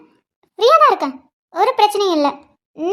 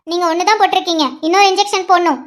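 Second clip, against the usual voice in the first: ordinary delivery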